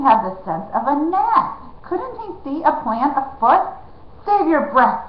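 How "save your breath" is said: higher, louder sharp retort; snapping. A narrator's reading. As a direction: cut him off. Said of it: "snapped Jemmy".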